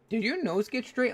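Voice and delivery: a hint of dad noticing something odd; surprised dad.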